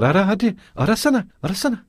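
high-pitched, repeated goading; challenging. A narrator's reading. Katerina goading Lujin to search, challenging. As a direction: egg him on insistently, voice up high.

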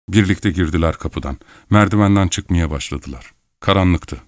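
usual speaking voice

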